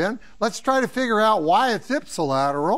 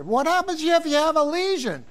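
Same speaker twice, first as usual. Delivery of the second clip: mock student voice, pitched way up; back-row student; 9 semitones higher than usual. Student interrupting talk of Eccles, high mimicked voice. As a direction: imitate the student's question in a high voice. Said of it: "some medical student in the back row said".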